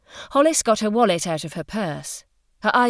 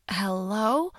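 tentative. A narrator's reading. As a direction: feel out whether anyone is there. Hollis unsure anyone is recording.

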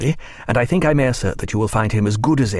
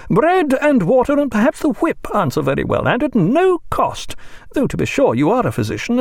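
metallic, dominant ring; 13 semitones higher than usual. A narrator's reading. Mrs Williams lecturing the doctor, domineering ring. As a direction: lay down the law with a ringing voice. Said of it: "her voice reaching its metallic, dominant ring".

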